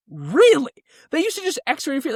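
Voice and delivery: can't believe it; incredulous. Retold or imitated. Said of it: "I was like".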